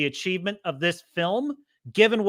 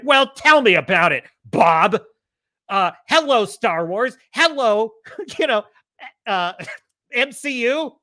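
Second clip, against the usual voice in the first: sarcastic angry rant; 7 semitones higher, 4 dB louder than usual. Real, not acted. Ranting sarcastically at Bob Iger.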